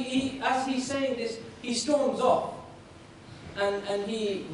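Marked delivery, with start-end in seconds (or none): none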